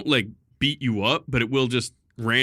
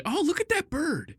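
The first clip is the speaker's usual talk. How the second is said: customers cooing at the bird; 4 dB quieter than usual. Retold or imitated. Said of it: "they'd say".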